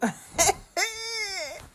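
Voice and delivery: high-pitched burst of laughter; high cackling laugh. Real, not acted. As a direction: let out a shrill giggle. Laughs after sleeping naked joke.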